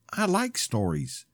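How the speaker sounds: lightly voiced small child, slow; a hint of a toddler talking; little girl voice. Little Lily telling her grandpa about stories.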